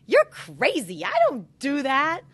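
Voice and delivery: loud, high scoffing retort; mock defensive scoff; dismissive retort. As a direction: brush off a spouse's complaint. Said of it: "instead of when your spouse gets upset".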